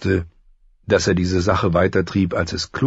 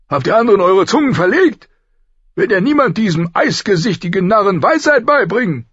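much higher, loud, fast royal outburst; angry king; 6 dB louder, faster than usual. Acted. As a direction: bark at the council like a furious king. Robert scolds the council. (wütender König, laut und hoch)

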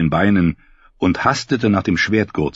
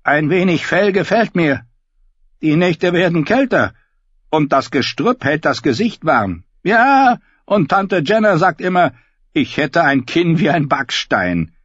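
high, loud bleating sheep voice; 10 semitones higher, 4 dB louder than usual. Acted. Davin voiced by narrator.

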